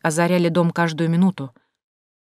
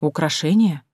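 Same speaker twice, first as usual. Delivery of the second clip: mild surprise on «Украшение», tinged with disappointment; surprised, disappointed question. A narrator's reading. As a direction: echo «Украшение» as a questioning, puzzled repeat. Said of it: "удивилась я, чувствуя разочарование". (удивлённо и слегка разочарованно переспросить)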